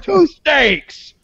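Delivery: high, loud, rude mock shout; yelled order. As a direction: bellow it crudely across a restaurant, pitch jumping up. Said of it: "'cause I had yelled".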